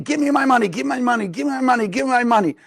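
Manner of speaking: a rich man's heated demand as he beats the man; demanding money. Acted. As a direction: hammer the demand over and over while striking.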